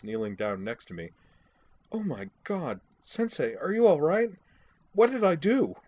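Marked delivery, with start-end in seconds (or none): 1.9-5.8 put-on high, anxious girl's voice rising to alarm; a frightened student checking on her teacher; high and panicky, louder; worried young girl. Worried girl at fallen sensei.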